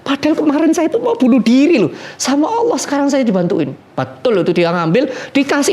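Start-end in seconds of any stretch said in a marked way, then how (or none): 0.1-3.7 tearful cry to God. Retold or imitated. Desperate man crying to God after the unexpected help, high and tearful. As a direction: wail it out to God, overwhelmed with relief. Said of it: "dia nangis".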